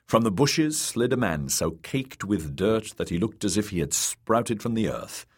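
usual speaking voice

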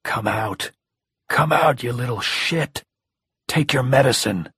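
hoarse madman shouting; wild unhinged ranting. A narrator's reading. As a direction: rage at a hiding child. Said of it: "A hoarse voice, the voice of a madman".

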